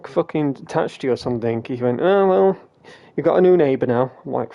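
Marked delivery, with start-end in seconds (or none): 2.0-4.1 higher, louder teasing imitation of dad; mock teasing voice. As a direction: play the cheeky dad ribbing his son. Dad's teasing quote retold.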